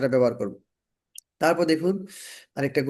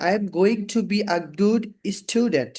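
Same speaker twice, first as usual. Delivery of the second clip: slow, clear teacher demo; slow model sentence; 2 semitones higher, much slower than usual. Shown as a demonstration. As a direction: spell out "I am going to be a good student" slowly. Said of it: "তো দেখুন".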